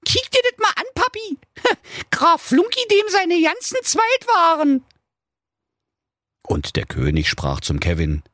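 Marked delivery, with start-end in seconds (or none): 0.0-4.8 very high, squealing princess; excited princess. Acted. Princess shows her dad Flunky's Instagram, squealing. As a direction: gush with a giggle. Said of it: "rief sie".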